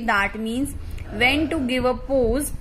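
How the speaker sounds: normal talking voice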